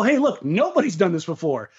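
ordinary talk

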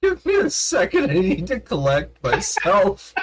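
high-pitched, cracking up while asking for a moment; real struggle not to laugh; holding back laughter. Real, not acted. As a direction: squeak it out through suppressed laughter. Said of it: "I'm doing my best to hold back laughter".

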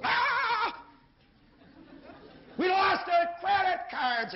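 high, loud, slow panicked cry; mock TV-ad panic, screamed high; panicked shriek. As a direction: shriek it like a couple who just lost everything. Couple in TV ad panics.

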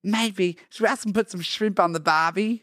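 put-on amateur Australian tourist accent. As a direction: put on a loose Aussie accent. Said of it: "we're Australian tourists".

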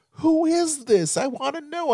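hammed-up high-pitched demand to know; imitating wife. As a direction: whine the question high, as the curious wife. Acting out his wife's excited question.

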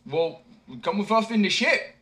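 high, loud call; simpleton inviting. A narrator's reading. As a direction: invite him aboard.

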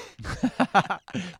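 high, loud real laugh bursting out; loud burst of laughter. Real, not acted. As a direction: let a big laugh explode. Big laugh at his own story.

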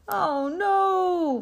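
high, loud, long "Oh, no" sliding down; mooing cow. A narrator's reading. Cow looks at the empty farm, mooing. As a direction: moo it out in dismay. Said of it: "mooed Abigail".

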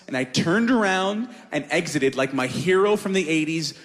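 ordinary talk, nothing marked

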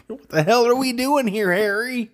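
high put-on silly movie voice; mock movie character. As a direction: squeak it out like a dumb movie character. Quoting Dumb and Dumber.

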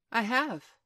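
carefree reply, lifted in pitch; blithe. A narrator's reading. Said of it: "he answered blithely in his own voice".

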